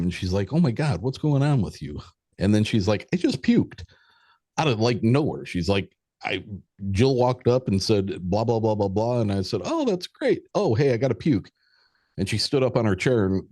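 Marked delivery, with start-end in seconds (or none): 0.5-2.0 imitating a giddy, higher-pitched daughter. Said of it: "happy, giddy, having a great time". 3.1-5.5 high-pitched imitation of a giddy girl; giddy daughter. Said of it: "happy, giddy, having a great time". 6.2-11.5 imitated girl, high and happy; giddy daughter. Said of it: "happy, giddy, having a great time".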